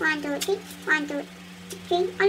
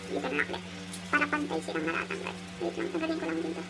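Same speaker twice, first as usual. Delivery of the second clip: hissing in pain; 4 semitones lower, 11 dB quieter than usual. Real, not acted. Muttering through pain.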